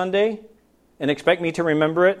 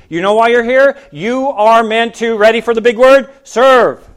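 raised, punchy build to one big word; emphatic preaching; 5 semitones higher, 6 dB louder than usual. Real, not acted. Preacher telling congregation why they are here, emphatic. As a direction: preach it loud, landing hard on the last word.

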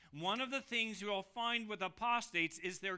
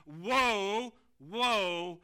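each woe called out much louder; loud eagle cry; 8 dB louder than usual. A narrator's reading. Eagle warning earth's dwellers, loud proclaiming cry. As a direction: ring out each woe from mid-heaven. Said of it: "saying with a loud voice".

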